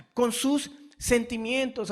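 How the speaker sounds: ordinary delivery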